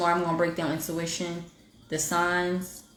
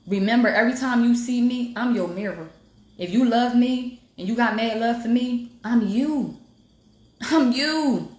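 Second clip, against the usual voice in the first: higher and louder, preaching with building conviction; rising preach; 6 semitones higher, 4 dB louder than usual. Real, not acted. Live host preaching her message to viewers, loud and lifted.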